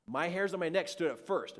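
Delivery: ordinary delivery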